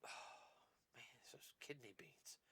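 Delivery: sighing, nearly whispered. Real, not acted. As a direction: breathe out a sigh. Sighs after apologizing for burping.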